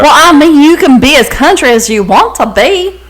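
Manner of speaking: loud, high country twang; put-on country accent. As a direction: lay on a big country accent. Mocks country accent in reply.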